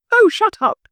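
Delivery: put-on old lady swats the pest, loudly; Mrs Claus snapping. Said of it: "Missus Claus goes".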